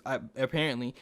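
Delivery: usual speaking voice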